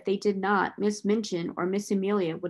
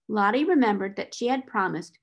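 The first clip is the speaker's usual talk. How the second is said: usual speaking voice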